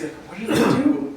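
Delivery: lightly acted baffled protest; mock bewilderment. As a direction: ask it high, as if wronged. Retold reaction to being punched.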